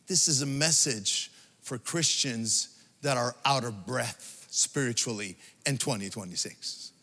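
ordinary talk

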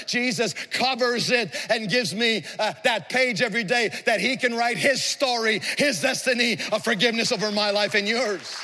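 high, ringing sermon delivery, driving phrase after phrase; impassioned preaching. Real, not acted. Preacher declaring forgiveness of guilt to the congregation, impassioned. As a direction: proclaim it to the whole congregation with force.

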